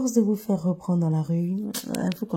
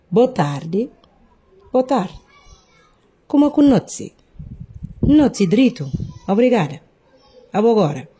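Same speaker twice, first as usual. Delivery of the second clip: acted dialogue; 6 semitones higher, 9 dB louder than usual. Shown as a demonstration. Acted greeting dialogue, higher.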